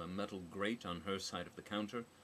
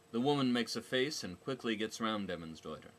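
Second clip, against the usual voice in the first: usual speaking voice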